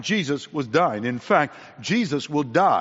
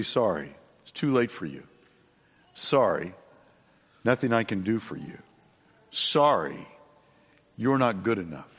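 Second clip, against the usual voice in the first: mock dismissal; 5 semitones lower than usual. Imagined Jesus turns the thief away, low mock dismissal. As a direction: drop the voice and turn them away, pausing between refusals.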